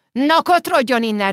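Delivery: put-on angry old man's outburst; shouted at. A narrator's reading. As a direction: chase him off with a shout. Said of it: "rivolt rá az öreg".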